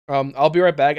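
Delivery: ordinary talk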